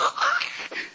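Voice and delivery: sharp gasp. Retold or imitated. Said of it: "it was like somebody went".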